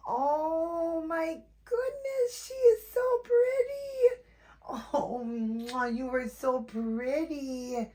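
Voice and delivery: slow high happy crying coo; overjoyed tearful gush. Real, not acted. First sight of the doll's face.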